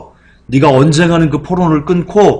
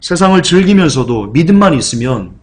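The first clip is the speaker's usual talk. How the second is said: ordinary talk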